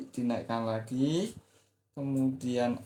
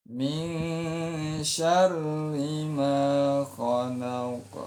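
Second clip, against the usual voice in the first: Min syarri ma khalaq chanted slowly on one level note; 5 semitones higher, 4 dB louder, much slower than usual. Shown as a demonstration. Teacher demonstrating the flat second-verse tone. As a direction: hold khalaq flat, do not raise it.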